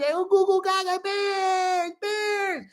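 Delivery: put-on high, slow baby babble with a little cry at the end. Retelling being a baby at the Super Bowl, high baby babble. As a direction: coo like a baby cheering for the Bears. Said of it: "I was like".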